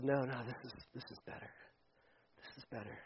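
put-on quiet, low voice of the old self. Pastor voicing the old self, low and hushed. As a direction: whisper the old self's tempting pitch.

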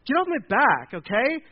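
high-pitched, fed-up protest; exasperated younger brother. Acted. David snapping at his critical older brother, exasperated. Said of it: "I could totally hear that".

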